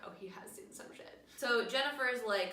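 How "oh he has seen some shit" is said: hushed, impressed whisper; whispered mock reverence; awed whisper. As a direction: breathe it in mock awe. Viewers reacting to the hook hand.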